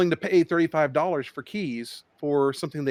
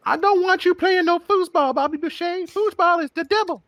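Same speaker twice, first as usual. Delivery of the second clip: much higher, slow, shrill Southern mother; Southern mama; 13 semitones higher, 3 dB louder than usual. Pretend. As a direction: scold like an overbearing Cajun mama. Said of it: "Waterboy mama impression".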